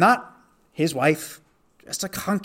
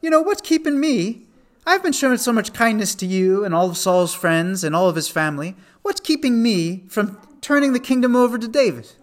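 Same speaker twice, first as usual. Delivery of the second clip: higher, indignant mock threat; retold angry threat; 7 semitones higher than usual. Abner threatening the weak king Ishbosheth, mock indignant. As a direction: make it a pushy, needling challenge. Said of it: "he threatens Ishbosheth and says".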